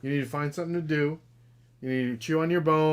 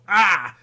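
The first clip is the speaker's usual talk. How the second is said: frustrated yelp; 6 semitones higher, 10 dB louder than usual. Retold or imitated. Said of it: "I'll go".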